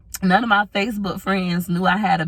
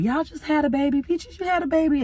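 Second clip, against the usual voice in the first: surprised onlookers; 10 semitones higher than usual. Retold or imitated. People at the hospital asking if she had a baby, high surprised. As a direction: gasp it out like a shocked bystander. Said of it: "And people like".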